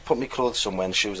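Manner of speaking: ordinary talk, nothing marked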